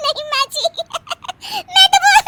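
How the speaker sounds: very high giggled protest; helpless laughing. Acted. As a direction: try to explain, collapse into cackles. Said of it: "Anshu हंसने वाली दवा खा लेती है". (हँसी में बात पूरी न कर पाना)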